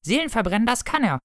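hoch und schnell spottend; hoch und flink weiter spotten. Acted.

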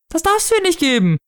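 very high, alarmed wail; wailing mother. A narrator's reading. Mother objecting to the sword gift, high wail. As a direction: protest in a high panicky cry. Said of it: "heulte sie".

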